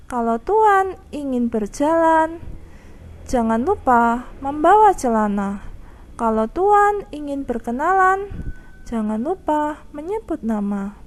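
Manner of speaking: teacher demonstrating pantun intonation marks; marked pantun intonation. Shown as a demonstration. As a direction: lift on "Tuan", pause at each comma, fall at "nama". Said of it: "ini contoh penerapan tanda intonasi".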